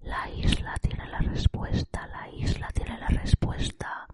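eerie slow whisper, no voice; ASMR whisper. Pretend. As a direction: breathe it like a ghostly island voice. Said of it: "Puedo hacer A S M R". (susurro ASMR inquietante)

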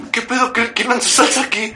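high-pitched mock scolding from the hostess; indignant hostess. Imita el reclamo de la dueña. (dueña indignada, imitada con voz aguda)

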